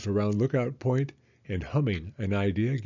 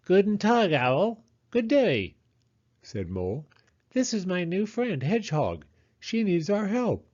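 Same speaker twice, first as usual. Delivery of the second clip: high, polite mole greeting; Mole's voice; 9 semitones higher than usual. Acted. Mole introducing Hedgehog to Owl, high and bright.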